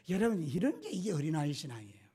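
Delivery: usual speaking voice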